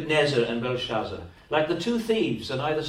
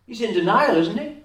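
denial pushed higher and louder; emphatic louder repeat; 7 semitones higher, 7 dB louder than usual. Shown as a demonstration. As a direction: hammer the point home this time. Repeated line, pushed hard.

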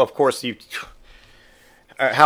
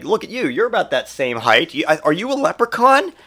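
teasing mock suspicion; 7 semitones higher than usual. Dad comparing son to the leprechaun, teasing disbelief. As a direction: pitch climbing to an incredulous question.

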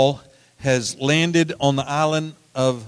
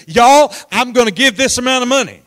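sudden high, loud outburst to the room; blurted pledge; 9 semitones higher, 8 dB louder than usual. Retold or imitated. Pastor blurting a pledge to fellow pastors, high and loud. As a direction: blurt it out before you can stop yourself. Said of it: "spoke up immediately and said".

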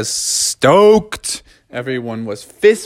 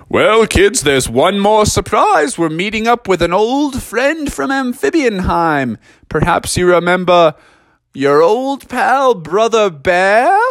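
higher, teasing fatherly voice; lightly voiced dad; 6 semitones higher than usual. Dad teasing the kids about one more surprise, playful higher voice.

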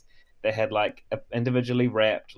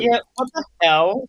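outraged; 12 semitones higher, 8 dB louder than usual. Real, not acted. Outraged agreement.

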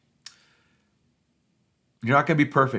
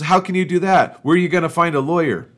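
Satan's raised, higher taunt; mocking retort; 7 semitones higher, 6 dB louder than usual. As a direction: throw it back with glee. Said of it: "And Satan goes".

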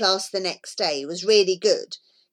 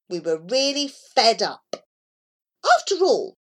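plain reading voice

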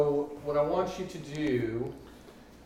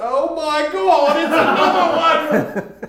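much higher, louder, slow shriek with laughter; panicked shriek; 13 semitones higher, 13 dB louder, slower than usual. Acted. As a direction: shriek in mock terror. Doctor panics at lizardfolk.

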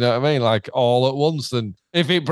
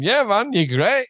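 cheery, higher-pitched all-clear; high and approving, mock machine encouragement; chirpy button voice; 9 semitones higher, slower than usual. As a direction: voice an upbeat status button. Voicing a reassuring green stream button.

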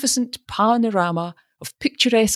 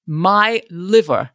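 slow, solemn and grave; 2 semitones higher, 4 dB louder, slower than usual. A narrator's reading. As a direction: weigh each word solemnly. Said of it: "said Mister Wingate solemnly".

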